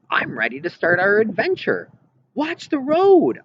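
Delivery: high, loud, thrilled grandma voice; a gleeful old lady, giddy at the wheel; Grandma laughing off her scared granddaughter while speeding, exhilarated; excited grandma. Acted. Gleeful grandma racing on freeway.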